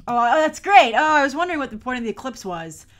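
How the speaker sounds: it just clicked, blurt it out; excited realisation. Real, not acted. Sudden realisation watching scene.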